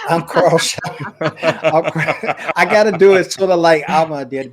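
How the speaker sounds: laughing. Real, not acted. Laughs through his intro.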